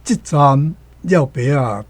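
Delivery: ordinary talk, nothing marked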